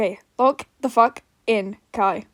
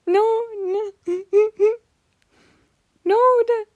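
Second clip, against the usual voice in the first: high squeaky voice; 10 semitones higher than usual. Shown as a demonstration. High Hootie voice attempts.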